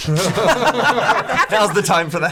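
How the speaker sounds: laughing. Real, not acted. Player joking about water offered after running, laughing. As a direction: crack up while getting the words out.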